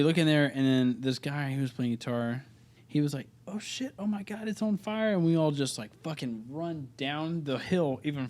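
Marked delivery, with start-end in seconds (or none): 3.5-5.1 high, hushed panic; mock alarm at a burning van; panicked. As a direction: gasp it out like you just saw flames. Guitar guy panicking at fire.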